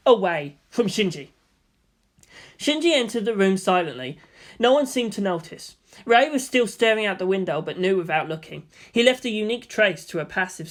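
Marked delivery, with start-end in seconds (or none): none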